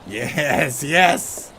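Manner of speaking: loud rising yes, yes; excited cheer. Real, not acted. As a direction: cheer it out in triumph. Celebrating a good throw.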